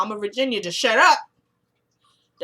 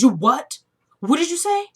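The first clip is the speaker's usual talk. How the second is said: higher and louder, stunned disbelief; shocked disbelief; 7 semitones higher, 5 dB louder than usual. Retold or imitated. Father hearing his daughter's confession, shocked disbelief. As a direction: react like you can't believe your ears.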